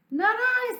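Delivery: high cat voice; a prissy high no; cat refusing. Acted. Cat refusing the hen, high voice. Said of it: "said the cat".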